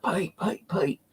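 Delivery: high breathy scared calling; whisper-scream for help in fear; terrified hushed cry for a friend; frightened call. Retold or imitated. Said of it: "I'm screaming out".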